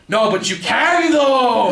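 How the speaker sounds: high, loud, drawn-out shout; imitating a loud drunk's yelled plea; drunken yell. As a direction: yell it slowly in drunken protest. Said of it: "he, like, yells it".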